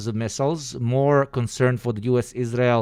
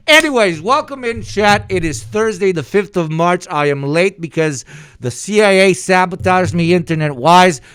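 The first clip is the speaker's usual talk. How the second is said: loud, high, energetic streamer welcome; hyped stream intro; 7 semitones higher, 6 dB louder than usual. Real, not acted. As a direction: greet chat with big energy. Stream opening hype greeting.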